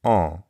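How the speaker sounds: en said as a French nasal vowel. Shown as a demonstration. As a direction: hum en through the nose. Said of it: "the nasal sound".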